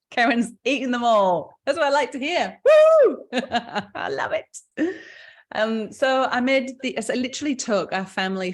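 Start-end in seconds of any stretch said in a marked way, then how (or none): 2.6-4.9 a loud, high whoop of delight; excited cheer. Real, not acted. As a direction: cheer the audience on with a big woo. Cheering at audience eating cookies.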